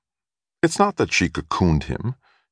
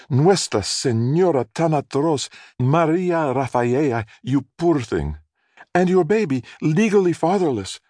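much higher, dramatic gossiping neighbor woman; pitying neighbor; 10 semitones higher than usual. A narrator's reading. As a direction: gush over her misfortune like a scandalized neighbor. Neighbor woman pities the mother.

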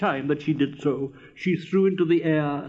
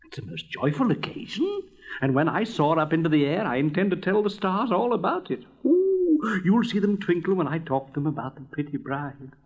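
little fire balloon, excited; 2 semitones higher than usual. Acted. Fire balloon joyful about telling the stars, excited. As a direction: bubble over with glee, leaping up in pitch.

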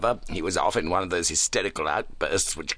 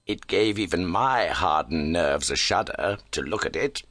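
ordinary delivery

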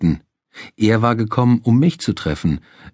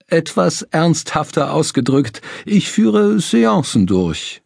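high, slow showman medium voice; theatrical medium; 7 semitones higher, slower than usual. Acted. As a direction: play a hammy spirit medium.

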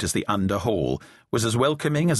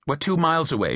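baldly; 7 semitones higher than usual. Acted. Isabel answers Charles baldly, no small talk. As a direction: blurt it out, no charm at all. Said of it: "she said baldly".

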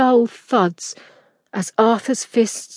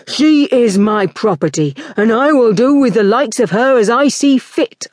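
louder, harsh villain's roar; 2 semitones higher, 4 dB louder than usual. A narrator's reading. Arthur roaring back at Adrian over his servant, angry. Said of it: "Arthur roared back".

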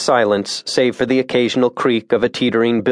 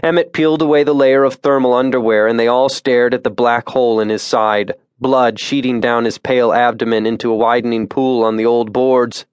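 usual speaking voice

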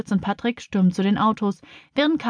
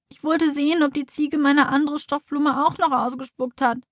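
put-on high, whiny child's voice; tearful; 7 semitones higher than usual. A narrator's reading. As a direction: answer plaintively, on the verge of tears. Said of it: "antwortet Tina mit weinerlicher Stimme". (mit hoher, weinerlicher Stimme)

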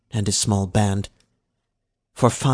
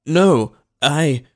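stammering; 5 semitones higher, 6 dB louder than usual. A narrator's reading. Slave stammers before the overseer.

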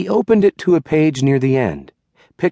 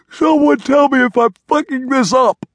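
higher, louder blurted frustration; exasperated; 10 semitones higher, 3 dB louder than usual. A narrator's reading. Diddle admitting he's botching the ritual, exasperated outburst. Diddle panicking mid-sermon.

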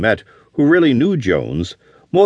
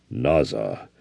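much lower, soft, grave Naza; grave Navajo reply; 12 semitones lower, 8 dB quieter than usual. A narrator's reading. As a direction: drop the voice deep and quiet for Naza.

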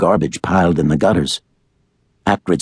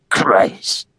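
hissed curse; 3 semitones higher than usual. A narrator's reading. Father curses, retreats.

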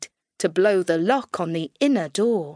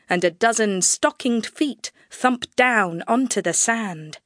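ordinary delivery

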